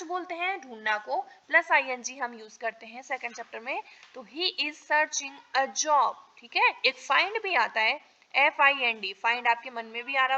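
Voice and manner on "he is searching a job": each word spaced out slowly for students; slow, clear classroom dictation. Shown as a demonstration. Teacher dictating model sentence.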